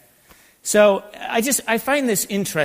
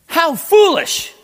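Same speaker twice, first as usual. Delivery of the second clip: rebuking exclamation; 11 semitones higher, 7 dB louder than usual. Acted. Reading Paul's rebuke aloud.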